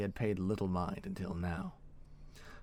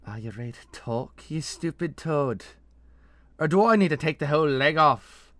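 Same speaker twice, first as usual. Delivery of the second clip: put-on menacing Nesrathi accent, rising to a loud demand; thick Nesrathi accent; 8 semitones higher, 13 dB louder than usual. A narrator's reading. Masked interrogator threatening her shivering captive, thick Nesrathi accent. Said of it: "uttered a voice laden in a thick Nesrathi accent".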